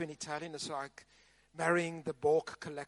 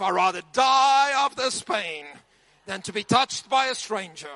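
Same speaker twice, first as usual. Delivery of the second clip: emphatic vow; 2 semitones higher, 7 dB louder than usual. Retold or imitated. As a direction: swear it out loud to your wife. Telling his wife he'd rather die.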